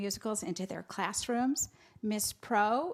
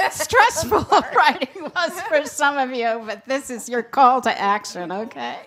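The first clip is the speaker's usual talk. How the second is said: laughing; 3 semitones higher, 10 dB louder than usual. Real, not acted. Laughs through writing is stressful.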